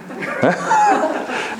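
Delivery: high-pitched amused laugh; sudden high laugh. Real, not acted. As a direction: laugh at your own dialect joke. Laughs at own dialect slip.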